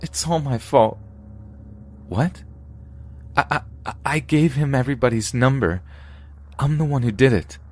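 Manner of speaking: high, shaky crying voice; tearful confession. Acted. Winnie confessing she leaked the numbers, tearful. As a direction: confess through tears.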